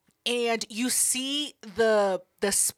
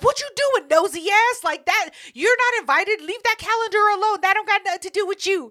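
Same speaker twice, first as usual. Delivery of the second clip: high-pitched mock telling-off; sassy scolding; 7 semitones higher than usual. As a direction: imitate the bird scolding a snoop. Scolding a snooper, acted out.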